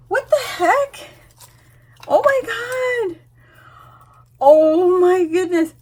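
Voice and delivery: high, drawn-out shock; overwhelmed, high and stretched out; amazed squeal. Real, not acted. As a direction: wail in delighted disbelief. Overwhelmed by gift, then calms herself.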